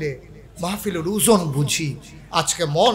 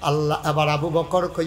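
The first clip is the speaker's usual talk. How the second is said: ordinary talk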